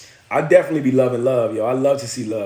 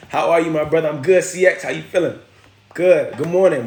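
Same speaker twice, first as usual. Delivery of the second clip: normal talking voice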